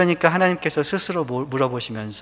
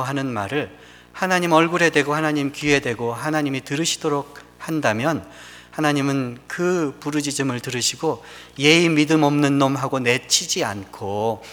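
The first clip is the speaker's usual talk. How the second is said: usual speaking voice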